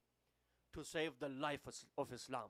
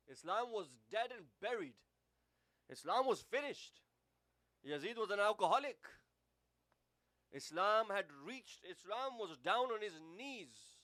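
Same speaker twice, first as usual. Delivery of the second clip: higher and louder, slowing at the end; impassioned preaching; 5 semitones higher, 5 dB louder than usual. Acted. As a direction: preach it with rising passion, then drag it out. Preaching the fall of Islam.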